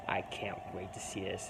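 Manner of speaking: hushed eager whisper. Real, not acted. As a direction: whisper it under your breath. Excited aside while watching match.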